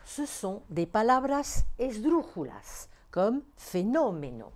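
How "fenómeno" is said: fenómeno stressed on NÓ; "fenómeno" with early stress on NÓ; stress on third-last syllable. Shown as a demonstration. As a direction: hit the nó of fenómeno, let the rest fall. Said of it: "la syllabe antépénultième".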